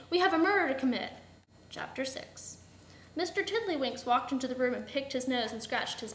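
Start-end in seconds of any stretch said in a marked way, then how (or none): 0.1-1.1 brisk, higher complaint; quick, impatient reminder; complaining. A narrator's reading. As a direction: complain in a hurry. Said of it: "Miss Scarlet complained".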